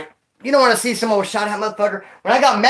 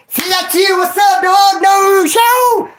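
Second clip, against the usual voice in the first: high, loud homie greeting call; excited high-pitched hello to an old friend; hyped greeting; 10 semitones higher, 4 dB louder, much slower than usual. Acted. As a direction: shout it out like seeing your old cellie. Greeting old cellmate Madman.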